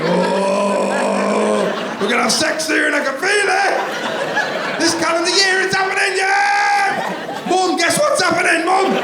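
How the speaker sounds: high, loud, gleeful squealing; giddy excited shout. Pretend. Partner squealing giddy news to wife and Mum, loud high glee. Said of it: "be like".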